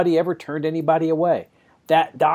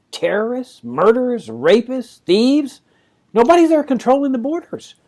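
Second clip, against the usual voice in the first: high, loud mock alarm over each word; sarcastic alarm; 9 semitones higher, 6 dB louder than usual. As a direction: exaggerate the danger sarcastically. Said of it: "I'm being sarcastic".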